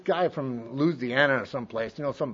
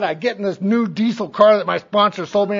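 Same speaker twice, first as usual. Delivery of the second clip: normal talking voice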